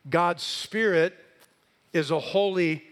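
loud, building pulpit cadence; rising sermon climax; preaching at full force. Acted. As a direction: drive each point home to the congregation. Climactic list of affirmations.

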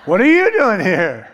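hammed-up shocked outburst through a laugh; loud mock shock. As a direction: blurt it out in comic astonishment. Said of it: "They're gonna go".

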